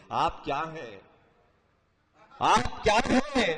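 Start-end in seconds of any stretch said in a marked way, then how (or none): 2.4-3.5 آپ کیا ہیں؟ declaimed slow, higher and louder; stately nazm recitation. Acted. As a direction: lift آپ کیا ہیں؟ slowly into grand verse. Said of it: "repeated take declaimed". (شاہانہ انداز میں آہستہ بلند آواز)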